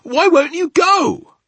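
high, loud, slow demanding wail; urgent cry. Acted. Sophie challenging the giant's refusal, urgent cry. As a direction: demand it loudly, drawing the words out. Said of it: "Sophie cried".

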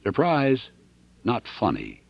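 ordinary delivery, nothing marked

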